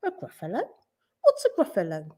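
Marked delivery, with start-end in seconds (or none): 0.0-0.6 high, soft questioning echo; puzzled question. A narrator's reading. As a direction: ask it up high, unsure. Snake asks what that is.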